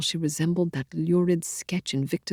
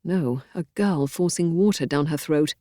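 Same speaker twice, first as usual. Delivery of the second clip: plain reading voice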